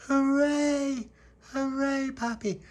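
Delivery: high, loud drawn-out hooray; excited cheering. Acted. Mammy cheering Pappy's story announcement, excited.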